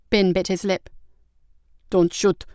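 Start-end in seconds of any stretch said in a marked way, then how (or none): none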